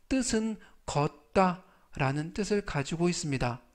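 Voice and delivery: usual speaking voice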